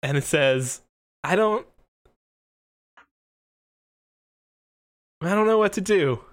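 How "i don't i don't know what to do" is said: put-on dejected, high kid voice; high and helpless, with a long hesitant pause; Gordon. Young trainer admitting defeat to the referee, dejected. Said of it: "sighs heavily".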